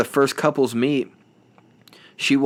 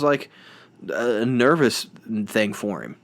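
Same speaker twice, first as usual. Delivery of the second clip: ordinary delivery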